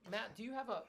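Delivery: ordinary talk, nothing marked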